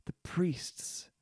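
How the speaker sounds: whispered word; sudden whisper for effect; hushed. Real, not acted. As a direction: drop to a breathy hush. Preacher drops to hushed word.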